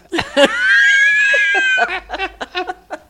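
high, squealing laughter; long high laughing fit; helpless giggles. Real, not acted. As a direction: crack up uncontrollably.

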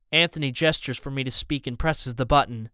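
ordinary delivery

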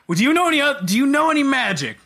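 high-pitched, put-on questioning; mock interviewer. Boss interviews the wizard about his magic, mock questioning.

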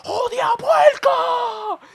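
very high, loud, drawn-out insult; mock outraged yell. Man agreeing with the bystander, full-force retold shout. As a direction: imitate the man finally letting it rip.

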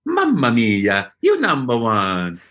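slow, beaming Mamma mia; cheerful Italian plumber catchphrase; Mario voice. Retold or imitated. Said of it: "if I say".

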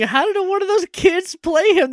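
high, loud incredulous squeak; baffled disbelief. Retold or imitated. Recalling her confusion about the actor, high baffled squeak. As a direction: act stunned the kid could play him. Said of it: "And I was like".

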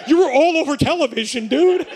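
loud, high, excited imitation; thrilled hype. Police officer congratulating the field-runner, loudly thrilled. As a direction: burst out loudly like a starstruck fan.

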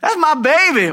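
high, loud proud mama cheer; hammed-up mama bragging. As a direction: shout it like a proud mom at graduation. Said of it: "when mamas do this kind of stuff".

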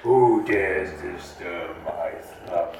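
grinding stone statue. Acted. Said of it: "you sound scary".

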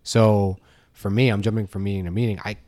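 usual speaking voice

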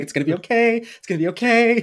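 high, crooning reassurance; playful high-pitched soothing; mock self-soothing. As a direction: comfort yourself in a small high voice. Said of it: "just being like".

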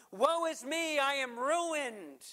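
a mild retold cry of despair from Isaiah; a touch of anguish, slow and lifted. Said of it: "where Isaiah cries out".

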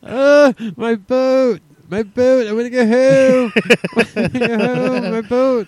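high, loud mock wail with held vowels; Jersey accent scream. Reprising the boat bit, screamed Jersey accent. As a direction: wail it in South Jersey, breaking into laughter. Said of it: "Let's scream in, uh, Jersey Shore".

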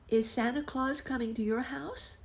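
put-on high, curious little boy voice; a small boy asking his friend; young boy.

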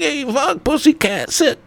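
very high squeaky blurted tics; Tourette's guy impression. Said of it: "he's like".

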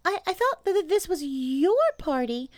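very high, stammering little animal; small, timid creature squeaking hesitantly; Piglet voice. A narrator's reading. Piglet timidly talks to Pooh.

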